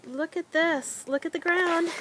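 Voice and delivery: loud, high and proud; excited. Real, not acted. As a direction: show it off with a burst of excitement. Showing off the greenhouse floor.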